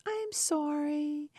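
high, drawn-out, pitiful apology; mock pathetic voice. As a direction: imitate a pathetic little plea, slowly. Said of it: "saying this very pathetical voice".

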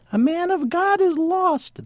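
very high, slow, strange monk voice; ventriloquist's mad Rasputin wail; crazy monk's voice. Acted. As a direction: throw a mad monk's voice into the palm. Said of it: "the palm said in the crazy monk's voice".

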